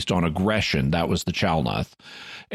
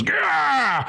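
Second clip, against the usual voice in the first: high mock cry of frustration; drawn-out exasperated alien yell; frustrated roar. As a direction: let out a high, frustrated "gah". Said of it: "getting so frustrated, he just goes".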